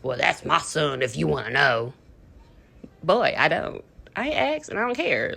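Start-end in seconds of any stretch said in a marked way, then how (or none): 0.0-1.9 lower, mocking the boy's father; a lightly lowered, mocking dad voice. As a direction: voice him as a smug dad. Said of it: "talking about some freaking".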